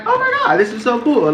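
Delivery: high, thrilled gasp of delight; sudden high excited burst; excited squeal. Retold or imitated. Excited reaction, big pitch jump.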